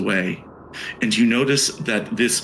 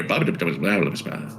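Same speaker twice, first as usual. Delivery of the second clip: gibberish; 5 semitones lower, 3 dB quieter than usual. Shown as a demonstration. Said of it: "All of you hear".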